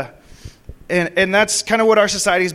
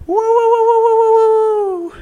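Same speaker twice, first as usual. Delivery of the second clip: long, high, loud whoops; real charismatic hollering woo; ecstatic whooping; 6 dB louder than usual. Real, not acted. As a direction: let the joy burst out in whoops. Ecstatic worship outburst.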